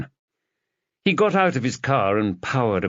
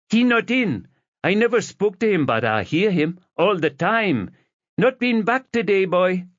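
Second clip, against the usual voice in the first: put-on thick Jamaican accent, pitched up high for the neighbour; 11 semitones higher than usual. Elderly Jamaican neighbour questions the detective, thick Jamaican accent. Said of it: "Thick Jamaican accent".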